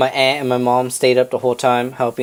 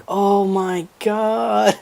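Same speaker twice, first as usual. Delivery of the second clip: high, very slow drawn-out gasp of wonder; awestruck; 5 semitones higher, much slower than usual. Retold or imitated. Family holding up the newborn puppy, slow awestruck wonder. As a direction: stretch it out in amazement.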